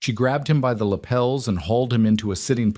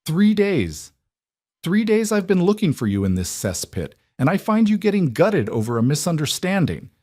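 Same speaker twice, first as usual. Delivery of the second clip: furious tirade; 9 semitones higher than usual. Acted. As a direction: keep hammering the accusation. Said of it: "mimicking the unhinged rage of a betrayed woman".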